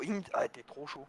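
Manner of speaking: ordinary talk, nothing marked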